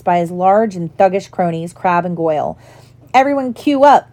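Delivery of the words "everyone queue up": higher, raised call to a crowd; understated crowd-gathering shout; mild roar. A narrator's reading. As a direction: call out mockingly to everyone around. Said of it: "Malfoy roared to the crowd".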